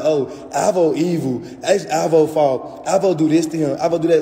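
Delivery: lightly voiced gossiping commenters, a bit put-on; mocking commenters. Streamer mocks her commenters, a mild sneering put-on voice. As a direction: parrot the comment section with scorn. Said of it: "all the people can be in the comments like".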